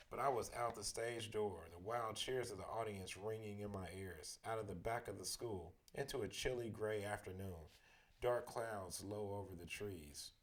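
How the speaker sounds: ordinary delivery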